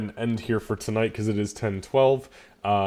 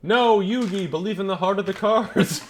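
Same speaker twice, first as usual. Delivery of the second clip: high, laughing anime hero line; 12 semitones higher, 5 dB louder than usual. Pretend. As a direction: ham it up like a cartoon duelist.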